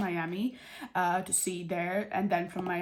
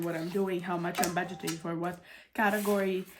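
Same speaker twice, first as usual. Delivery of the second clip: usual speaking voice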